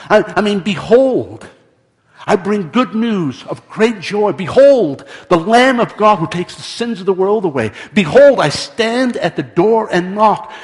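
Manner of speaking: loud, high, ringing proclamation; preacher driving each phrase home; full-force preaching. Real, not acted.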